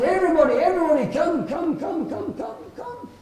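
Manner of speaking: high, loud call that fades away on the last comes; calling everyone. Acted. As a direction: summon the whole village from the log. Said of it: "and he shouted".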